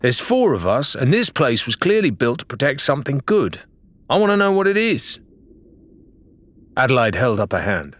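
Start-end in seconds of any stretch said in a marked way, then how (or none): 0.0-5.2 Lorena, hotly. A narrator's reading. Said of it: "Lorena said hotly".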